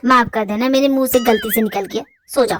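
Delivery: low male voice, mock apology; boyfriend voice. Boyfriend mockingly apologising to girlfriend at bedtime, low voice.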